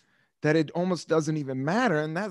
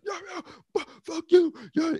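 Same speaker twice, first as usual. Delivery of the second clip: high mock yell in a quarrel; mock shouting match; 14 semitones higher than usual. Reliving a teenage shouting match, mock yelling. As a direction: spit it out like an old street fight.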